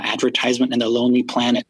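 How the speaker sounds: ordinary talk, nothing marked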